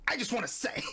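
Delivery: Snowbike Mike whisper. Pretend. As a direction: try a whispered hype impression. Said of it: "I can't do a Snowbike Mike".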